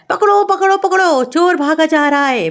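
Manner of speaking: high-pitched urgent cry for help; storyteller's acted outcry, pitched well up; raising the alarm. A narrator's reading. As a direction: call out to catch a thief. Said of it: "रसोई में शोर मचाया".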